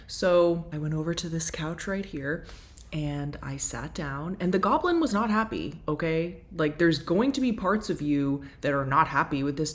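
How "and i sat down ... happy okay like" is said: ordinary talk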